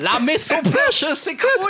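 put-on high, slow, laughing German accent; imitating German accent. Repeating a friend's title, in mock German accent. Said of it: "C'est l'accent allemand".

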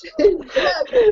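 high and slow, shaking with laughter; gasping it out between laughs; laughing. Real, not acted. Repeats it through laughter.

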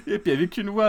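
normal talking voice

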